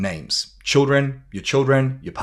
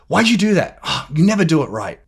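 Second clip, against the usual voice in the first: high, loud exasperated complaint; accusing husband; 5 semitones higher, 4 dB louder than usual. Acted. Husband to wife over something done, mock exasperated. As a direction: scold with a huff, acted.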